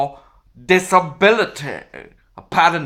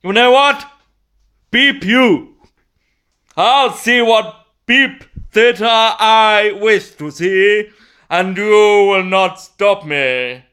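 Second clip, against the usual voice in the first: loud, higher, theatrically defiant; acted outrage, loud and emphatic; indignant retort; 4 semitones higher, 5 dB louder, slower than usual. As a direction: tell them off in a grand, raised voice. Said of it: "I said to them".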